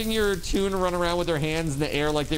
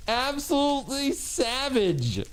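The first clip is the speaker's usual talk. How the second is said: amazed exclaiming; 9 semitones higher, much slower than usual. Real, not acted. Reacting to savage attack.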